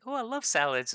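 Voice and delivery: very high, loud mock delight; imitated gushing. Husband imitating wife about salads, gushing loudly. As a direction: squeal it like an overjoyed wife, put on.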